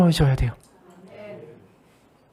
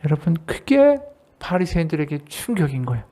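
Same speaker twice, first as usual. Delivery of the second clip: ordinary talk, nothing marked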